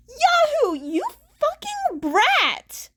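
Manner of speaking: high, loud and drawn-out yell; furious yell. A narrator's reading. Mirako catching Izuku, loud and furious. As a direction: bellow at the runaway brat.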